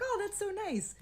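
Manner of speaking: high-pitched "aww, how nice" reaction; retold delight. Reacting to a friend's story, retold delight. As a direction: lift the voice high, sweetly impressed.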